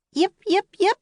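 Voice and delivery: very high, loud yips; puppy barking. A narrator's reading. The puppy barking out the window. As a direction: bark like a small dog. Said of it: "barking at the woods".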